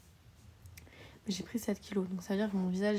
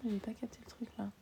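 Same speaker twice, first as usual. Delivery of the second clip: whispering; 7 dB quieter than usual. Real, not acted. Confused aside.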